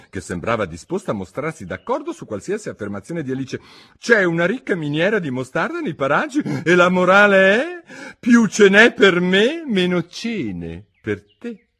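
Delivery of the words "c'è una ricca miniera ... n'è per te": higher, louder, pompous duchess. A narrator's reading. Duchess lectures Alice, pompous and higher. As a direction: preach a moral she cannot recall.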